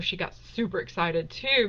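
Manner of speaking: ordinary talk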